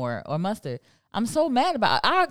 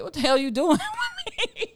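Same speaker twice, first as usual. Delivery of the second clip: laughing through words; 9 semitones higher than usual. Real, not acted. Ketchup rant, laughing.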